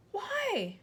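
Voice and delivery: put-on high desperate girl; pleading child. A narrator's reading. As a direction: beg to know. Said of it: "Matilda cried".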